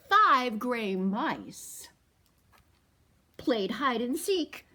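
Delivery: picture book read to toddlers; storytime reading. A narrator's reading. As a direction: read it slowly and big for the kids. Reads the picture book aloud.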